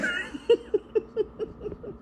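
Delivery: squealing, airy fit of laughter; high wheezing laugh. Real, not acted. Recalling husband's wrong birthday answer, squealing laugh. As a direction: laugh high and breathless until it fades. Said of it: "I'm laughing out".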